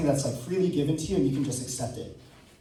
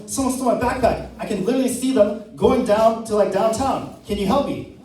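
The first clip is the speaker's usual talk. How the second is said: high, louder mock emergency call; pitched-up, agitated retold plea to an operator; urgent plea; 7 semitones higher, 5 dB louder than usual. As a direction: act out a worked-up call for help. Acted emergency call, reporting theft.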